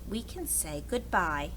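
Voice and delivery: plain reading voice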